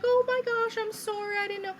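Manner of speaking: very high, gushing imitated apology; squeaky-sweet mock apologetic nurse; super-nice nurse. Nice nurse apologizes to patient in labour, gushing high imitation. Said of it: "She was super nice".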